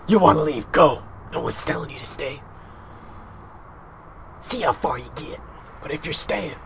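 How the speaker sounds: a hint of hard, menacing leader, trailing into near silence; lightly voiced tough leader laying down the law; badass Rick. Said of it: "hardcore, badass Rick".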